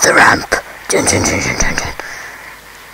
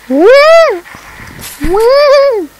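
high loud whoops; cheering; 12 semitones higher, 4 dB louder than usual. Real, not acted. Gamer whooping at his rider's flight, high and loud. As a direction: whoop with delight.

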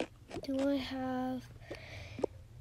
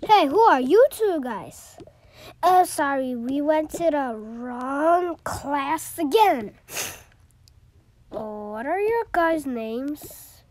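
loud voice; 2 semitones higher, 11 dB louder than usual. Pretend. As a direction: call it out loudly. Teacher confronts newcomers.

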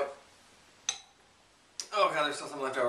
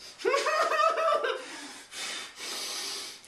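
pained laugh. Real, not acted. Laugh after swallowing wasabi.

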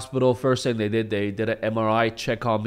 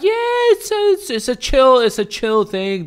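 very high, loud goofy voice; much higher silly drugged voice; morphine-high reassurance; 13 semitones higher, 6 dB louder than usual. Retold or imitated. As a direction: wave it off, drugged and carefree.

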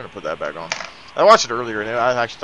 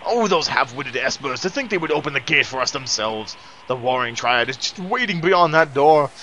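sneering panto villain; 4 semitones higher than usual. Acted. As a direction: relish every word like a scheming madman. Reads villain's lines aloud.

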